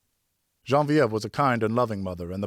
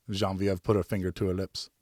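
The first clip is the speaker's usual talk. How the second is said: ordinary delivery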